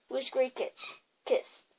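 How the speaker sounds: plain reading voice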